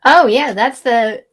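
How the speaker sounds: ordinary talk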